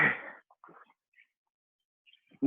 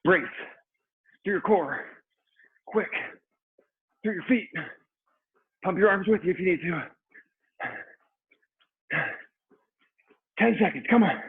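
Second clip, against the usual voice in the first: aerobics instructor; 6 semitones higher than usual. Real, not acted. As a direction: drive them through the last rounds. Coaching the final hard rounds.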